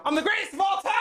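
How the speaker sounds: high, strained boastful cry; over-the-top megalomaniac yell. Acted. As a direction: manic alien bragging at full tilt. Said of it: "sounding like [name] on, like, steroids".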